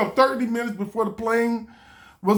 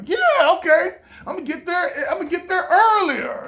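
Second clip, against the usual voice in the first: happy; 10 semitones higher than usual. Retold or imitated. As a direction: squeal like good news just came in. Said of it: "I was happy".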